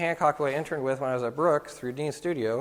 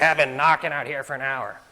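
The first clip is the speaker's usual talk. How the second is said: grumpy complaint; 5 dB louder than usual. Retold or imitated. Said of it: "And he's like".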